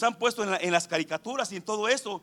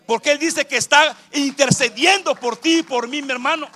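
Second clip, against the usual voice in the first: raised, loud preaching build on intercession; fervent preaching; 7 semitones higher, 7 dB louder than usual. Acted. Preacher to congregation about the Spirit's groans, loud and fervent. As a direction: preach it out full force, voice lifted.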